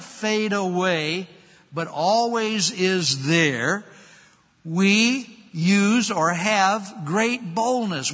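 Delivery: loud, high, slow pulpit delivery; preaching at full force. Acted. Preacher proclaiming hope to the congregation, loud and slow. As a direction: preach it out to the back row.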